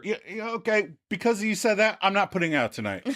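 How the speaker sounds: high mock-huffy retort, quickening at the end; Chandler, huffy. Chandler retorting to Monica's criticism, huffy. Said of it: "doesn't like smelling the brown".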